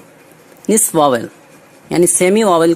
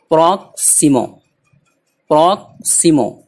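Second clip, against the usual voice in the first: Proximo slower, with a gap after prok; word in parts. Shown as a demonstration. Teacher drilling a new word, proximo in separated parts. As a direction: split proximo into prok and simo.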